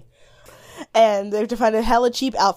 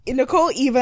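usual speaking voice

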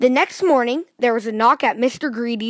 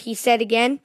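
plain reading voice

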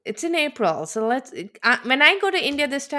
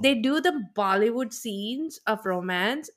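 ordinary delivery

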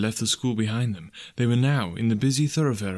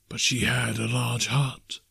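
ghost voice; slower than usual. Acted. Ghost speaks, slow.

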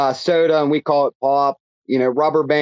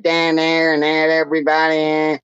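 words slurred into one flat Pittsburgh vowel; lazy Pittsburgh accent; 3 semitones higher than usual. Shown as a demonstration. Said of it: "real lazy way of saying things".

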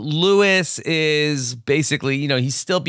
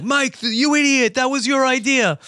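mock Harvey scolding; 11 semitones higher than usual. Harvey scolding Mike, mocked much higher. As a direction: snap at Mike in a high mocking voice.

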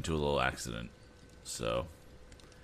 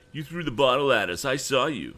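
put-on higher, louder boyish accusation; angry accusation; 9 semitones higher, 7 dB louder than usual. A narrator's reading. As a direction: point the finger at him. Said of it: "cried Sam".

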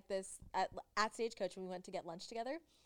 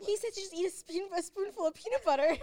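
high, louder, bubbly put-on voice; imitating Oscar; 10 semitones higher, 6 dB louder than usual. Imitating a friend telling her trainer's advice, high and bubbly. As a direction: play the friend eagerly sharing a tip.

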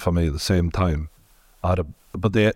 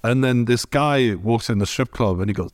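ordinary talk, nothing marked

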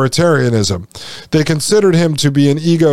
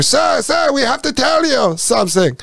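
high, breathless little aide; mock high-pitched flunky; eager underling; 10 semitones higher than usual. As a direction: squeak it like a nervous lackey running alongside. Mocking Harari as eager underling.

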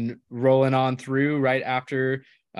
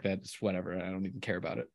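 much softer, mock-sulky throwaway; sulky dismissive; 4 semitones lower, 11 dB quieter, faster than usual. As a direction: pretend not to care, trailing off. Mock sulking over rival's pick.

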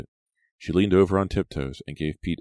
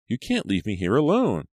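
high, pleading protest; crying out; 7 semitones higher, 4 dB louder than usual. A narrator's reading. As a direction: beg them not to leave. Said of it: "Maya cried".

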